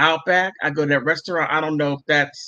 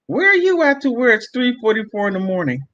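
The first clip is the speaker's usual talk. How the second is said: put-on higher, accusing jealous girlfriend voice; 6 semitones higher, 3 dB louder than usual. Retelling brother's jealous girlfriend, higher accusing voice. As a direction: mimic a suspicious girlfriend. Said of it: "And she said".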